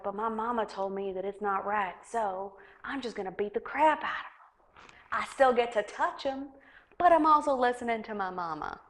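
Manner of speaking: a hint of a Southern country boy explaining himself; mild Southern accent, mocking closeted homophobes. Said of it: "giving them a Southern accent".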